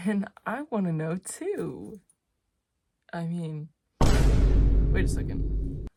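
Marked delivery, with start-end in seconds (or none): 4.0-5.9 sudden realization. Real, not acted. Sudden interrupting exclamation.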